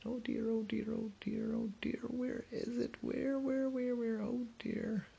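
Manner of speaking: high, soft whispered fretting; high tiny whispering butterfly. A narrator's reading. Ollie follows the sound.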